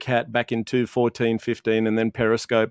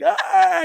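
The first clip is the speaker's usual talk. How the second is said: clownish exclamation. Retold or imitated. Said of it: "I'd be the clown".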